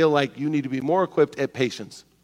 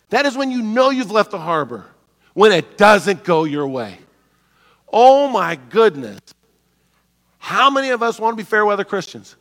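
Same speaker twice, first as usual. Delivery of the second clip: normal talking voice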